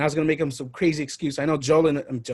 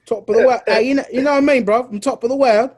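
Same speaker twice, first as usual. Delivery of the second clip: hammed-up Aussie accent, pitched higher and louder; mock Australian accent, pitched up; put-on Aussie accent; 7 semitones higher, 6 dB louder than usual. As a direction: play a cartoon Australian on top of the world. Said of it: "not offended with my accent".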